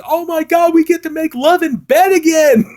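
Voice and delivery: high, loud hammed-up squeal; mock-ecstatic wife voice; giddy delight. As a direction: gush it out like a thrilled lover. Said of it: "ends the movie with a great line".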